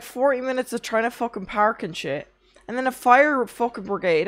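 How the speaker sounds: usual speaking voice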